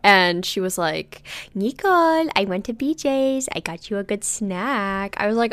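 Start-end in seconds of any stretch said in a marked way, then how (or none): 1.5-2.3 high, drawn-out imitation of mom; mom's cheery call. Mom phones her daughter, high put-on voice. 2.4-5.2 slow, singsong mom impression; imitated proud mom. Mom tells daughter about a snack, slow put-on voice.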